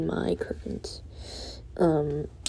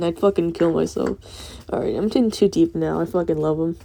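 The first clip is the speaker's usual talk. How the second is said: usual speaking voice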